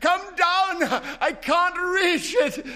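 very high, loud, strained calling. Acted. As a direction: shout up the stairs in panic. Wife calling husband from downstairs.

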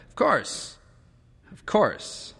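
high, shaken woman's voice; shaken agreement. A narrator's reading. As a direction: agree through tears.

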